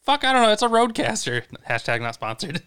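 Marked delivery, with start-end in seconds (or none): 0.0-1.4 much higher, louder, flustered shout; exasperated outburst. Retold or imitated. Answering Justin about the soundboard, a sudden loud fluster. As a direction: blurt it out, caught off guard. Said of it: "And I was like".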